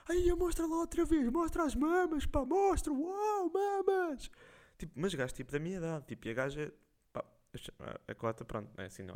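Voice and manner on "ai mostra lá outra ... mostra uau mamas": much higher mocking chant of the lads; jeering young guys. Young guys in the live teasing the woman, high and mocking. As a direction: egg her on like a crowd of teasing boys. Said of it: "E os gajos, tipo".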